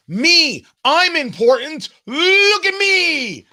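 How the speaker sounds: high, loud, slow whining plea; hammed-up needy wail; mock whiny Al Gore. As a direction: whine like a spoiled child begging for attention. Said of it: "Al Gore should have just said".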